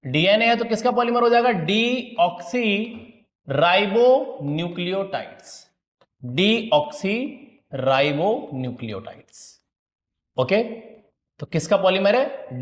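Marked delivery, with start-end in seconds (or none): 1.5-9.6 long term broken into slow, separate parts; slow dictation. Real, not acted. Teacher writing out what DNA is a polymer of, slow dictation. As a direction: spell it out slowly, pausing between parts.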